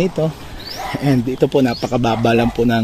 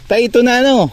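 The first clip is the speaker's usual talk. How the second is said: much higher raised voice; calling out; 13 semitones higher, 5 dB louder than usual. Real, not acted. Vlogger checking with the landowner, calling out high. Calling a question to older man.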